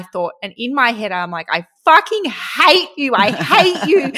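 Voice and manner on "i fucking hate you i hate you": higher, louder retold cry of envy; mock hateful outburst. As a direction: blurt it out in exaggerated resentment. Retold jealous outburst in her head.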